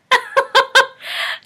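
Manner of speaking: sudden loud high laugh; high burst of laughter. Real, not acted. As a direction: laugh out at your own joke. Laughs at own joke.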